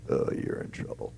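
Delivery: low, grave lawyer voice; mock ominous lawyer warning. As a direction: deliver it like a grim attorney breaking bad news. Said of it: "literally in this lawyer voice".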